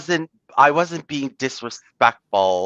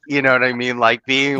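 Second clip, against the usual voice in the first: ordinary talk, nothing marked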